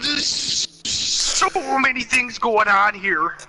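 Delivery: very high, slow strained yelp; Bobcat Goldthwait voice. Acted. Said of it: "I wish I could do Bobcat Goldthwait".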